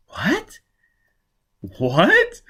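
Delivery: sharp high disbelieving "What?"; startled question. Acted. Quat stunned by the news.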